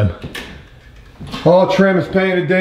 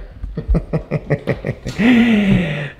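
chuckling at own joke; a long, helpless run of chuckles; laugh. Real, not acted. Vlogger cracks up at his urinal joke, real laugh.

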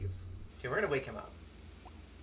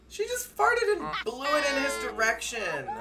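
laughing; 10 semitones higher, 4 dB louder than usual. Real, not acted. Still laughing at fart.

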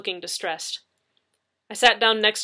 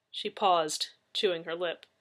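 usual speaking voice

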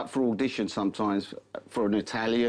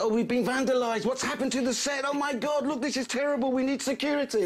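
high, alarmed, flustered cries; panicked bosses; 13 semitones higher than usual. Retold or imitated. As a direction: squeal in horror at the set. BBC bosses panic over graffiti.

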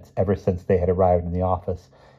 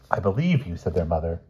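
usual speaking voice

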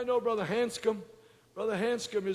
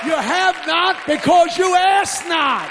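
shouted sermon, loud and high, hammering each line; full-force preaching; 8 semitones higher, 13 dB louder than usual. Acted. Preacher shouting to his congregation about why people came. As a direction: preach it hard to the back row.

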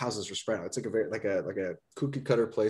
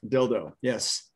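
ordinary talk

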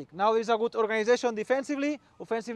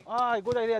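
ordinary talk

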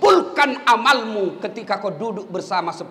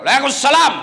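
louder, brisk Waalaikumsalam; stern, short reply to a caller; firm reply; 4 semitones higher, 6 dB louder than usual. Shown as a demonstration. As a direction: answer curtly and firmly. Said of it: "Coba kalau tegas sedikit".

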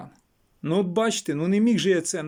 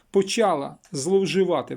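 ordinary talk

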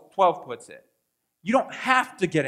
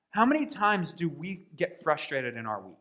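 ordinary talk, nothing marked

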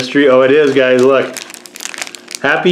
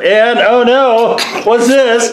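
surprised; 10 semitones higher, much slower than usual. Real, not acted. Bear's head breaks off.

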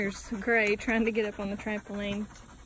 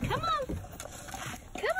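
much higher, sing-song puppy talk; high coaxing voice; 4 dB quieter than usual. Real, not acted. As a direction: coax a puppy sweetly.

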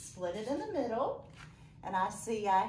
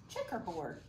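Checkerboard said softer as one word; softly blended word; 4 semitones lower, 6 dB quieter than usual. Shown as a demonstration. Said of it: "let's see if we can say the word together".

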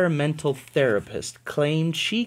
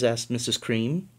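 ordinary delivery, nothing marked

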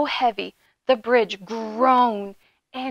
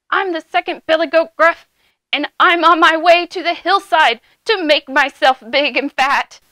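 louder, moderately raised goat voice; not-so-small goat voice; 4 semitones higher, 7 dB louder than usual. A narrator's reading. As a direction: announce it as a sturdy middle goat. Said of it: "in his voice was not so small".

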